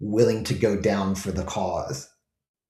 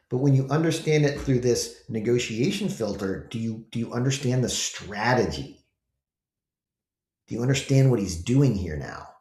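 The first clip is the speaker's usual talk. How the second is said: usual speaking voice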